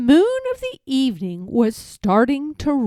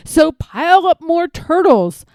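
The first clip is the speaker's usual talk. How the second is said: loud, higher royal command; a haughty king thundering at his subjects; 5 semitones higher, 5 dB louder than usual. A narrator's reading. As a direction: boom out the order from the throne. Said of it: "his royal voice thundered".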